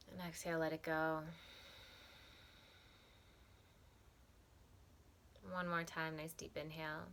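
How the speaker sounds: calm, softened meditation voice with long pauses; unhurried, even, soothing meditation guide; guided meditation. Acted. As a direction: guide them gently through breath and body, leaving space between phrases.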